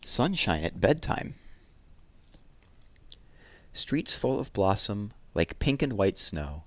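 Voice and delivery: put-on lively, lilting read-aloud to children; poem reading. A narrator's reading. Teacher reading a summer poem to children, lively and lilting. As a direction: savour each image, bright and rhythmic.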